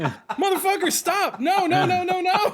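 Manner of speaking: very high, loud comic protest; frantic, high-pitched string of refusals; panicked mock yell. Acted panic at being outed.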